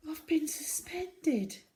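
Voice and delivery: high, slow admission; a little girl admitting something shameful; small girl confessing. A narrator's reading. Zoe voiced in story.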